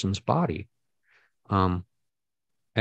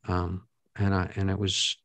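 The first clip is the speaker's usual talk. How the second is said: ordinary talk, nothing marked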